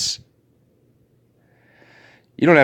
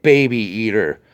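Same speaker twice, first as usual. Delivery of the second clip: ordinary talk, nothing marked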